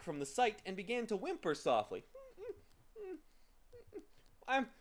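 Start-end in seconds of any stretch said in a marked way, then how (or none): 4.5-4.7 very high, loud tearful confession; hammed-up blubbering creature; sobbing. A narrator's reading. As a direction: sob it out in a high wail. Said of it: "he sobbed".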